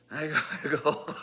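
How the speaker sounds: ordinary talk, nothing marked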